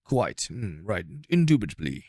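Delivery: hammed-up posh British gentleman accent. Teasing his British friend in a mock posh British accent. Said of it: "like, a posh accent".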